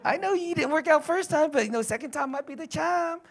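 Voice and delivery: put-on admiring female voice; other women admiring. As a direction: mimic women cooing over a man. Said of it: "other women saying".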